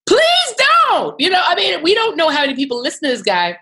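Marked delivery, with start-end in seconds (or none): 0.1-1.2 high, loud pleading; exasperated plea. Acted. Journalist begging viewers not to drink it, high loud plea. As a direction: beg them, loud and high.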